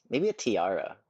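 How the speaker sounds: ordinary talk, nothing marked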